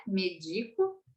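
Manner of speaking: "Medico" said softly with closed E; closed E pronunciation. Shown as a demonstration. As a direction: say "Medico" without the open accented E. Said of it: "Senão ficaria médico".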